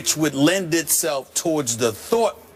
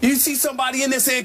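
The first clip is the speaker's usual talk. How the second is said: excited boast; 12 semitones higher than usual. Real, not acted. Proudly revealing his commercial voice.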